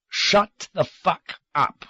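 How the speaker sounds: a slowed, savage snap. A narrator's reading. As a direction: cut him off with savagery. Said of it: "a real savagery in his voice".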